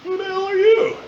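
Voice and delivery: high, defiant thought; high outraged mock reply; indignant inner voice. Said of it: "And I thought".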